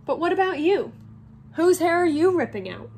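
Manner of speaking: challenging question. Acted. Harry turns the plan on Hermione, challenging. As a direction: throw the question back at her.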